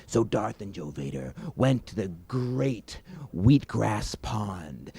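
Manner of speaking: ordinary delivery, nothing marked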